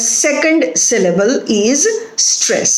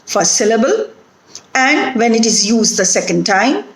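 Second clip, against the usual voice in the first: ordinary talk, nothing marked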